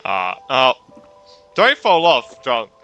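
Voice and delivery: ordinary delivery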